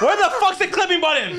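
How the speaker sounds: high, loud, laughing exasperation; frantic shout. Real, not acted. Player hunts for the clipping button, frantic shout. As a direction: yell it while cracking up.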